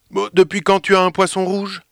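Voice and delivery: surprised and a bit mocking; astonished. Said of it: "d'un air étonné". (d'un air étonné, plus aigu)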